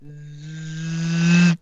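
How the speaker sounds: rising, growing zzz; imitated swarm buzz. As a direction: make a swarm of wasps closing in.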